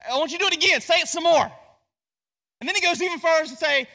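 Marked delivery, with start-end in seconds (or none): 0.1-1.5 raised, louder mocking encouragement; sarcastic taunt. Elijah goading the priests to pray again, sarcastic. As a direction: egg them on with mock helpfulness. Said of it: "He's being kinda sarcastic".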